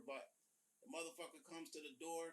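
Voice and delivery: usual speaking voice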